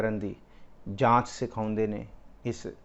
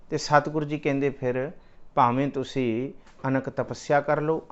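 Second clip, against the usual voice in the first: ordinary delivery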